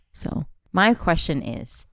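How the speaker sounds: ordinary delivery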